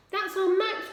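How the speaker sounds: high, loud joyful recognition; Ma bursting out on hearing of her son; high excited outcry; cried out. A narrator's reading. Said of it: "cried Ma".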